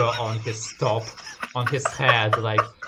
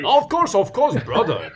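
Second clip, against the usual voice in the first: higher, louder character voice; hearty greeting; 10 semitones higher, 6 dB louder than usual. Acted. Ozurag warmly greeting the dwarf, lightly voiced.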